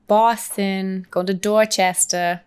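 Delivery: slow, drawn-out Boston American accent; a lightly done Boston accent, dragged slowly. Shown as a demonstration. Her best Boston accent when the host asks for an American one. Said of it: "could do an American accent".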